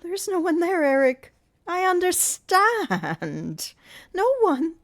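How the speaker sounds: frightened insisting. A narrator's reading. Christine insisting to Eric, high frightened voice. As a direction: insist desperately.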